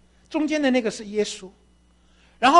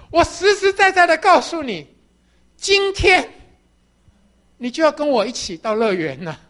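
loud, high, laughing announcement; Jesus promising paradise, beaming; joyful promise; 4 semitones higher, 6 dB louder than usual. Acted. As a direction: deliver the good news with delight.

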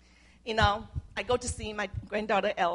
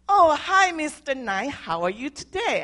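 bright, much higher, louder cheerful hello; friendly greeting; 7 semitones higher, 11 dB louder than usual. Retold or imitated. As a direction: greet him with overdone friendliness. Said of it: "be friendly to him and show kindness".

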